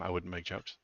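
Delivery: normal talking voice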